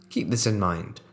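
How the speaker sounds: plain reading voice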